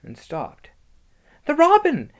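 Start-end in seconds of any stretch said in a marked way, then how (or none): 1.5-2.1 put-on high, loud cry as a little girl; urgent, high-pitched exclamation; excited cry. A narrator's reading. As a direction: call out in sudden alarm. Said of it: "cried Lucy".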